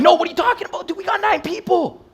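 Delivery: high-pitched squeaky disbelief; hammed-up high-pitched objection; incredulous protest. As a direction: protest in a mock high voice, way above normal. Protesting to doubting friends, retold.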